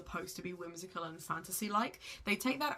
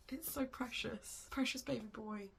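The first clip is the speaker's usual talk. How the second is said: tender; 4 semitones higher, 6 dB quieter than usual. Real, not acted. To her viewers about a book she loves, tenderly.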